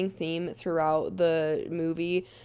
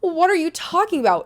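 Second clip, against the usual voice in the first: high, loud imitated surprise; imitating someone caught off guard; mock disbelief; 10 semitones higher, 6 dB louder than usual. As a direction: act out a startled, baffled comeback. Acts out Sylvie's startled reply.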